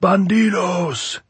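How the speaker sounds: high, loud, slow gleeful yell; happy shout. Acted. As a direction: shout it with wild glee. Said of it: "he shouted again happily".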